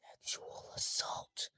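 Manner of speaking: slow, hushed whisper; barely voiced, drawn-out secret; confidential whisper. Real, not acted. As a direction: whisper it like a forbidden term. Guarded aside after y'all didn't hear.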